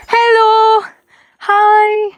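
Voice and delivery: high, loud sing-song greeting to the horses; cheerful hello to horses. Real, not acted. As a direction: call out brightly to the animals.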